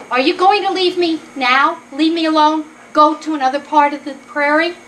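small creature bargaining firmly; insistent mouse. Acted. Mouse presses buffalo to leave.

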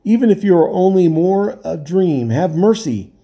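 high, frantic begging; terrified man begging not to be left; desperate pleading. A narrator's reading. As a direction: clutch at them with every word. Castaway begs to be taken.

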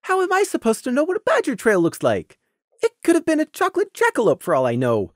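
high, weak protest. Acted. Scenic weakly protesting to Prey, high and defensive. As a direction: whine it defensively. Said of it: "Scenic weakly protested".

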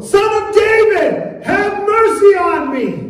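high, slow insistent shout. Acted. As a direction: stretch the cry out, refusing to be hushed. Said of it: "he kept crying out all the more".

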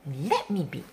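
usual speaking voice

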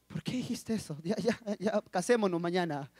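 low, hushed lovestruck plea; smitten aside. Acted. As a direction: blurt it under your voice. Acts his smitten inner reaction.

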